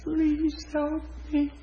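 high, soft, drawn-out crying voice; imitated tearful plea. Crying woman in pain asking for help, high and tearful. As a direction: plead slowly in a small high whimper. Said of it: "She just cried".

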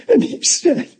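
ordinary delivery

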